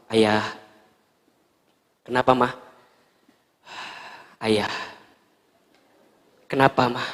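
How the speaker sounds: low, soft tender exchange; soft gentle call. Retold or imitated. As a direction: call him quietly and gently. Soft exchange wife and husband. (panggilan lembut dan lirih)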